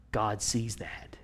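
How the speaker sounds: slow hushed whisper. Real, not acted. Preacher whispering that God sees. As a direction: breathe it out slowly.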